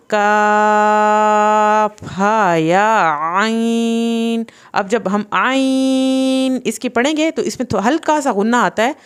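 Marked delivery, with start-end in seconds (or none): 0.1-4.4 "کاف ہا یا عین" with a light k and long held vowels; thin kaf. Shown as a demonstration. Teacher showing the thin kaf, slow elongated recitation. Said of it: "یہ کاف پتلا جب آپ نے پڑھنا ہے".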